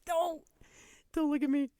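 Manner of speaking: hammed-up squeal of denial; mock protest. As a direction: play up the embarrassment, laughing it off. Embarrassed mom reacting to son.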